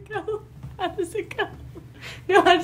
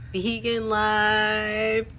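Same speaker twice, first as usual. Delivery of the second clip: slow, lower "vegan life"; drawn-out announcement; 5 semitones lower, 4 dB quieter, much slower than usual. Real, not acted. Showing the packed vegan food, drawn-out announcement. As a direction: stretch both words out slowly.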